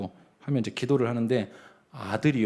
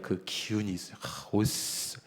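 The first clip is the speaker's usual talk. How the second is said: normal talking voice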